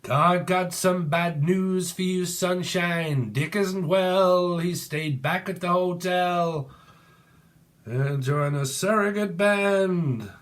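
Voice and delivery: menacing manager. Pretend. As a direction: deliver bad news like a sinister boss. Acts a menacing film-line quote.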